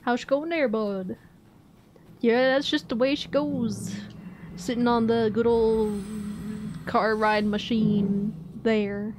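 demonstrating Maritime Canadian speech; Maritime accent. Shown as a demonstration. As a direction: put on an East Coast Canadian accent. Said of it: "how would I do a maritime accent".

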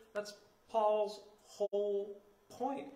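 slow, stumbling over "Paul's whole"; tongue-tied. Real, not acted. Pastor fumbling "Paul's whole point" mid-sermon, tongue-tied and slow. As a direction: trip on "Paul's whole point" as the sounds run together. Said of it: "trying to say Paul and whole".

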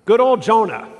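a playful nudge at the prophet's name; fond mock emphasis. Shown as a demonstration.

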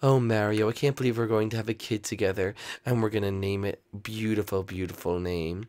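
dreamy Rosalina. Acted. Rosalina voiced, dreamy about baby.

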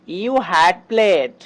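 very slow, stretched out; slow model sentence. Shown as a demonstration. Teacher slowly modelling the English example. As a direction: lay each word down for learners. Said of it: "যেমন".

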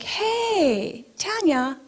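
high, singsong phone greeting; Janet. Retold or imitated. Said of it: "phone call from my friend Janet".

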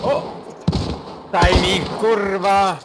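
loud high frustrated curse; angry shout. Real, not acted. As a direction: yell it out in frustration. Frustrated at a bad moment.

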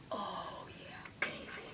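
hushed, breath-only whisper; whispered self-admiration. Pretend. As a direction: whisper it slowly to yourself in mock admiration.

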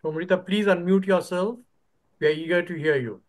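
ordinary talk, nothing marked

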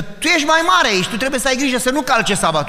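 acted scolding. Acted scolding of the older son.

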